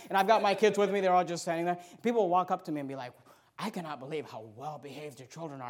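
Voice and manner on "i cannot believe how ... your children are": low, very soft admiring quote; imitated amazed stranger. Acted hushed compliment, pitched down. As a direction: bystander at the bank praising his kids, hushed amazement. Acting out admiring bank customers.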